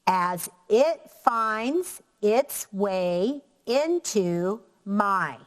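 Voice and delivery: very slow, "as... it... finds... its... way... in-to... my"; syllable-counting read. Shown as a demonstration. Teacher reading for students to count syllables, slow and separated. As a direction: space every syllable so it can be counted. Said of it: "I want you to count the syllables this time".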